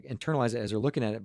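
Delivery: usual speaking voice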